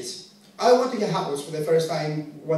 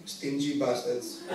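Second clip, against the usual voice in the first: muttered scorn; 6 semitones lower, 8 dB quieter, slower than usual. Retold or imitated. Imagined Greek audience voice.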